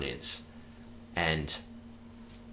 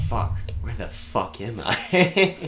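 slow, higher retold exclamation; mock bewilderment; 5 semitones higher, 4 dB louder, slower than usual. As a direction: play being utterly lost, for laughs. Lost in meadow, retold.

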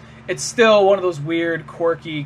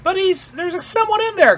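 put-on much higher, pleading boy's voice; pleading kid; 13 semitones higher, 4 dB louder than usual. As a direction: protest like a frightened kid. Shinji protesting to dad.

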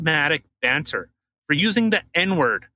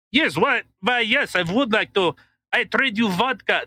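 higher-pitched Russian trader, lightly caricatured; mock Russian accent; 6 semitones higher than usual. Russia begging to trade vodka, mock Russian accent.

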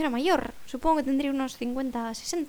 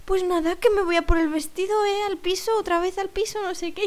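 put-on higher-pitched older-lady prattle; acted chatter; 6 semitones higher than usual. Older woman announcing she goes back for the dress, high prattle. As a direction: rattle off the errand in a raised voice.

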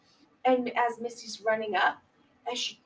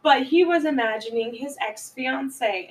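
ordinary delivery

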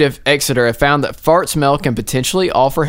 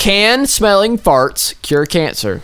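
dragged out, higher pitched question; dramatic slow reveal; 6 semitones higher, much slower than usual. Acted. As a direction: spell out the question slowly for effect.

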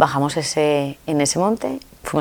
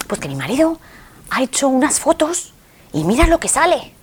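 excited Mexican woman; 8 semitones higher than usual. Retold or imitated. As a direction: call out to her friend, thrilled to share. Imita a la mexicana. (la mexicana, muy aguda y emocionada, llamando a Inma)

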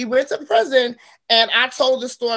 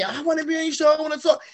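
higher-pitched mock pleading to be included; imitated eager requests; 6 semitones higher, much faster than usual. Host imitates people asking to join her show, eager and higher. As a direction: lightly act out eager callers.

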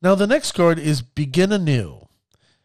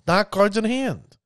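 ordinary delivery

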